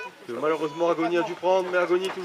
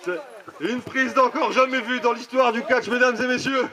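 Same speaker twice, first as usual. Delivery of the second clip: wrestling commentator; 6 semitones higher than usual. Acted. Play-by-play announcing to audience.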